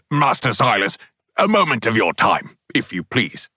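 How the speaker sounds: a hint of a stiff, formal butler; lightly voiced polite butler. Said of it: "Beauregard's voice, formal".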